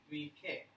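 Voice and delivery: "Mike" pronounced "Mee-keh", a final vowel on the K; imitated beginner's "Mike" as "Mee-keh"; spelling-based Mike. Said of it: "Because M I K E".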